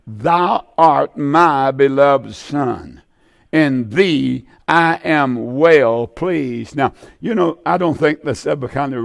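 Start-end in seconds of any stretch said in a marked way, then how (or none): 0.1-6.8 slow, weighty reading of God's words; slow solemn scripture reading. Acted. Preacher reading God's words to his Son slowly and solemnly. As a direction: let each word of the verse land slowly.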